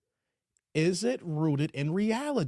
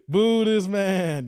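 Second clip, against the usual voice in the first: drawn-out boo; 6 dB louder, much slower than usual. Retold or imitated. Callback to the movie quote.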